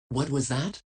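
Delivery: normal talking voice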